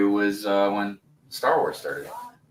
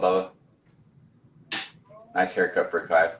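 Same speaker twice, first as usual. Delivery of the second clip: ordinary delivery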